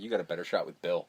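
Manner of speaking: deadpan mumble. Retold or imitated. Doug's deadpan reply in retold scene.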